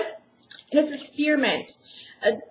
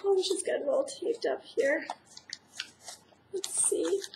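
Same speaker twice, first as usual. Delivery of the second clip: usual speaking voice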